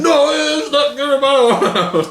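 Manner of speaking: high, slow, laughing mock protest; quick reaction imitation. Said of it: "I like your quick reaction".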